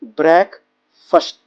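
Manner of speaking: ब्रेक then फर्स्ट, a pause between; split into syllables. Shown as a demonstration. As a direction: break it in two, brek, then first. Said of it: "इसके नाश्ते के लिए हम word use करते हैं".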